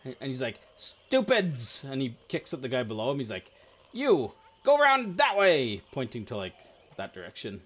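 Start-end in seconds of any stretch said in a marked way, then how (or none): 0.8-1.8 high, loud scolding yelp; scornful goblin boss. Acted. Goblin boss berates his minions. 3.9-5.8 high, loud command; barked goblin order. Acted. Goblin boss orders minion around.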